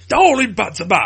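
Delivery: loud, high mock cry of "Darling Buds of May"; mimicking her pronunciation. Teasing a co-host over how they said it, loud high mimicry. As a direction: throw "Darling Buds of May" back as an exaggerated impersonation. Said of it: "The way you said it".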